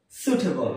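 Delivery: সুইটেবল said "soo-te-bol"; low-pitched সুইটেবল without a w glide; সুইটেবল starting with plain "soot"; correct pronunciation. Shown as a demonstration. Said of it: "কি হবে?".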